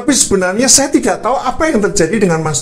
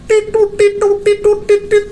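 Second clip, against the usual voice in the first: high beeping mimic of a phone ringing; phone ring imitation. Preacher mimics the phone ringing while calling Toto, high beeping. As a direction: chirp "Titut, titut" like a ringing line.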